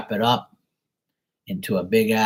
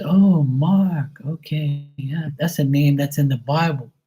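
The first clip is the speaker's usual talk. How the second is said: high, drawn-out mock old-aunt voice; great-aunt quote; 6 semitones higher, 3 dB louder than usual. Deaf great-aunt finally hears the name, high mock voice. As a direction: imitate the great-aunt taking it in, pitched up. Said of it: "She goes".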